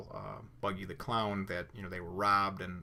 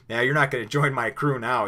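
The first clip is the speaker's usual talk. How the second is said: mad Luffy; 3 semitones higher, 8 dB louder than usual. Retold or imitated. Angry Luffy in jail, retold.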